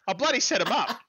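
high, bubbly, laughing brag; excited. Real, not acted. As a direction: gush with glee about it. Gleeful about the emotes.

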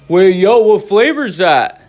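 exaggerated teasing bro voice; mocking kids. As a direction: jeer like a kid making fun of a stranger. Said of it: "because they're mocking me".

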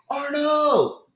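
drawn-out, high, loud holler; calling out. Retold or imitated. Stranger calling his name a second time, loud calling out. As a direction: imitate a stranger shouting after someone. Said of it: "I hear my name again".

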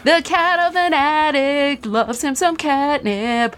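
high, slow playful echo; sing-song it back teasingly. Pretend.

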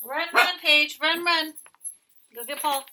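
loud high urging call. Real, not acted. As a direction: hurry the dog along, loud and high. Urging Paige to fetch Paul.

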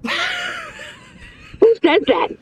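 high laughing shout, very slow; laughing outrage. Real, not acted. American laughs at the mock hotel, high shout. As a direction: cry out in laughing disbelief. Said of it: "laughing protest".

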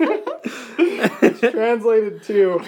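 slow words through laughter; can barely get the words out for laughing; laughing hard mid-sentence. Real, not acted. Still laughing mid-story.

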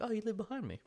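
high-pitched retold exclamation; imitated friendly neighbour. Neighbour realising who lives behind her, high imitated exclamation.

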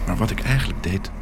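plain reading voice

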